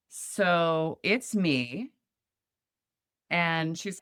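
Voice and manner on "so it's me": drawn-out, deadpan so it's me; slow realization. Retold or imitated. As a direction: let it land slowly.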